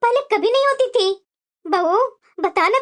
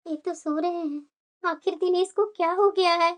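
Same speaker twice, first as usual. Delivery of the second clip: hushed so as not to wake; 6 semitones lower, 7 dB quieter than usual. Acted. Husband asleep, she talks low.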